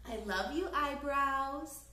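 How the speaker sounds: usual speaking voice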